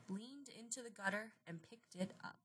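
plain reading voice

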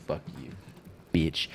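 ordinary delivery

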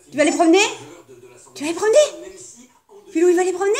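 high eager questions to the dog; excited pet talk. Real, not acted.

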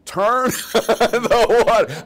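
high, loud, drawn-out shriek; mock outraged yell. Cooking wife yelling up to the roof, mock outrage. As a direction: imitate an exasperated wife yelling, stretched and shrill. Wife's outburst about the water.

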